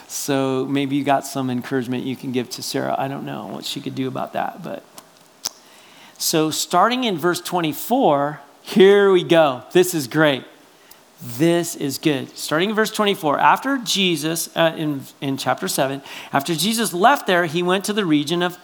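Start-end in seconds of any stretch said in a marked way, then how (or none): none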